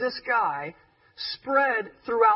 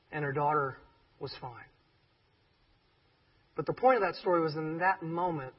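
ordinary talk, nothing marked

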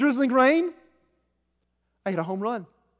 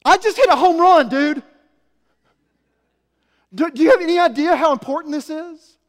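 higher and much louder, proudly indignant; excited outburst; 7 semitones higher, 9 dB louder than usual. Acted. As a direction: burst out, bragging about the home run. Proud outburst after home run.

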